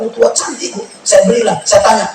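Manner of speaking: ordinary talk, nothing marked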